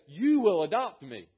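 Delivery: loud, insistent demand. Retold or imitated. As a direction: order the family to take you in. Child demanding adoption.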